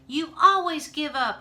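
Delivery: 높고 크게 터뜨리는 고함; 포기하는 아빠에게 화가 나 소리치는 딸; shouting. A narrator's reading. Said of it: "I shouted".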